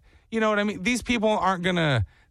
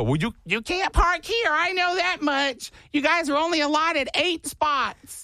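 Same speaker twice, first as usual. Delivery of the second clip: much higher, prissy neighbor laying down rules; fussy HOA neighbor; 11 semitones higher than usual. Retold or imitated. HOA neighbor scolding party guests about parking, prissy and high. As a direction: play the nitpicking neighbor policing parking. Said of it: "You go".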